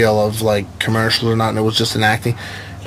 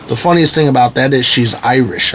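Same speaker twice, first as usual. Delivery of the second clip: ordinary talk, nothing marked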